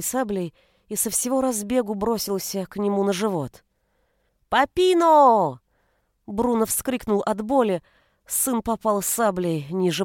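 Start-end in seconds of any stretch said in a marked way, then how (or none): 4.5-5.6 high, loud, drawn-out call; child's gleeful yell. A narrator's reading. Son running at papa with toy sabre, yelling. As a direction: stretch it out like a charging little boy. Son charges at father, calling out.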